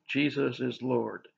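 slow, soft, mantra-like repetition. Shown as a demonstration. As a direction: repeat it slowly like a calming mantra. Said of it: "I just repeat to myself".

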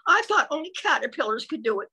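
ordinary delivery

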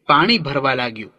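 usual speaking voice

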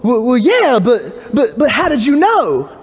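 imitating a startled man; mock surprise. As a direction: caught off guard, sputtering. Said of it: "And all surprised".

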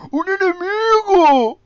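high, loud, slow Castilian-dub shout; mock Spanish dub voice. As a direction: bellow like the Spanish dub of the game. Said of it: "Con acento español, como el juego". (imitando el doblaje español a gritos)

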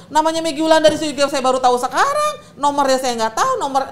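heated defense. Retold or imitated. Wife heatedly defending herself to her husband. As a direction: rattle off her defense loudly.

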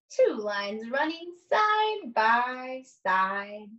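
put-on slow, sing-song read-aloud to young children; lingering on each rhyme, long pauses between pages; story read aloud. Reads picture book aloud slowly.

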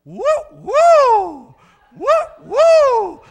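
very high loud whooping woo; parrot whoop. Retold or imitated. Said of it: "she fluffed up".

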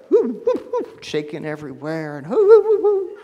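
high yelping whoo sounds like an eager dog; excited dog whoops. Retold or imitated. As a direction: yip like a dog thrilled to go walking. Excited dog at tennis shoes.

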